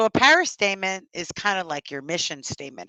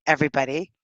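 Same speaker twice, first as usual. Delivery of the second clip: normal talking voice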